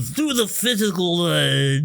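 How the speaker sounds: goofy put-on voice, starting high; physical therapy voice. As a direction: silly struggle through the words. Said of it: "is that your physical therapy voice?".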